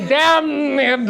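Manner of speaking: Damned! high with a laugh, as dam-ned. Shown as a demonstration. Teacher laughing while saying Damned! for fun. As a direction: giggle through Damned!. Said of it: "isn't that so much fun to say".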